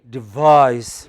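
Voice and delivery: DEVISE with a loud, long VISE; light de-, booming -VISE in Devise; final-syllable stress. Shown as a demonstration. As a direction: punch the second syllable of Devise. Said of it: "bring that distinction very clearly".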